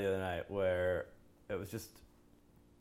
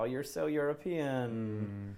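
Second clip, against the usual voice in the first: sarcastic; 4 semitones higher, much slower than usual. Retold or imitated. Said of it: "So I was like".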